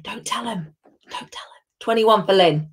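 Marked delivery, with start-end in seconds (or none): 0.0-1.6 hushed, voiceless whisper; conspiratorial whisper. Pretend. Host slipping Lynn number twenty-one, secretive whisper.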